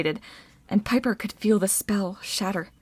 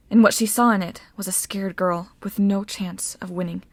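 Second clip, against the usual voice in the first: plain reading voice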